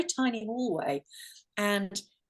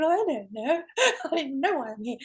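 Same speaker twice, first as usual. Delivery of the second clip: higher, laughing self-quote; quick, giggly confusion; amused bewilderment; 5 semitones higher, 3 dB louder, faster than usual. Retold or imitated. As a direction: act out being clueless, breaking into a laugh. Acts out her own bewildered reaction.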